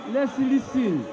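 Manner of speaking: high-pitched call over the house; calling for order. Real, not acted. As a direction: call the room to attention, pitched well up. Chair calls noisy house to order.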